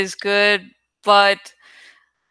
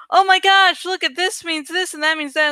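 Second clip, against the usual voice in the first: high gushing fan voice, mocking; mock excited fan; 8 semitones higher than usual. Mocking fans who read meaning into art, gushing high. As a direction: lightly ham up an overeager fan theorist. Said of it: "fans have gone".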